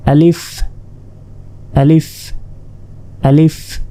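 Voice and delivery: আলিফ said clearly, ending on a short f sound; teacher modelling আলিফ; model pronunciation. Shown as a demonstration. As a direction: recite আলিফ slowly for students to repeat. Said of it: "আমি এখন যেভাবে অক্ষরগুলি উচ্চারণ করব".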